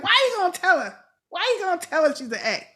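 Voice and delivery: high-pitched, incredulous protest; exasperated disbelief. Retold or imitated. Viewer objecting to a character giving away a secret, exasperated. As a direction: question the choice as if scolding him.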